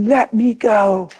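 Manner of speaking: mock angry child, drawn out; boy's angry cry. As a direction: stretch it out like a furious child. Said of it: "he said".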